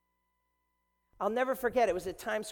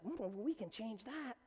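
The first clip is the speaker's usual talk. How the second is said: put-on soft old saint; 3 semitones higher, 9 dB quieter than usual. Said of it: "And she was like".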